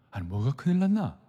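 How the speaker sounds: normal talking voice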